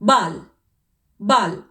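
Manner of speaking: ബാൽ with an open long aa, not ball; German pronunciation. Shown as a demonstration. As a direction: model ബാൽ clearly for students. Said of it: "ജർമ്മനിൽ അപ്പൊ എങ്ങനെയായിരിക്കും". (German ബാൽ ഉച്ചാരണം)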